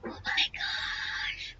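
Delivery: breathless, slow whispered gasp; shocked whisper. Real, not acted. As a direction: whisper it, stunned. Shocked gasp at game screen.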